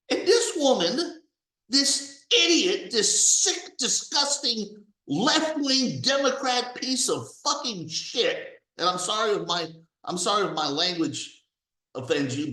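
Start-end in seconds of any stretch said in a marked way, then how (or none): 0.1-8.6 angry rant. Real, not acted. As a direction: spit out each insult, building in anger. Ranting insults at the woman.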